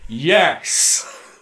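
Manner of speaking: triumphant drawn-out yes; retold cheer. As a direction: act out leaping up to cheer. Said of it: "get out of my chair and go".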